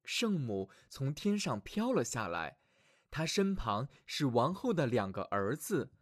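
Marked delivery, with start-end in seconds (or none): none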